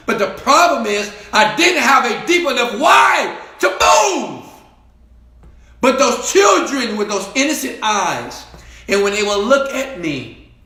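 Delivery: high and loud, driving the point home; preaching shout. Acted. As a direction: shout it out like a preacher at full force. Preaching climax.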